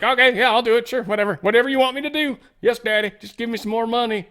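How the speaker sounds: high-pitched, mock-obedient pleading; eager yes-man. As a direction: play an over-eager kid begging for money. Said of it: "'Cause Ukraine will use it. They're like".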